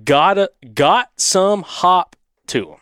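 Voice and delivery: slow, halting Southern drawl; laboured, drawn-out Southern accent; Southern twang. Acted. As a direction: stretch OLD and HOP in Alabama twang. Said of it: "trying to do the accent".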